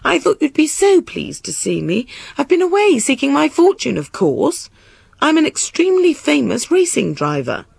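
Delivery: high-pitched, airy lady's voice; self-satisfied queen, pitched high; breezy queen. A narrator's reading. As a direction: explain it away in a high, grand voice. Queen voiced, explaining her absence.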